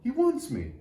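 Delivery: bursting with delight; excited cry. A narrator's reading. Little tree crying out to his friends, excited. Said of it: "cried to his friends".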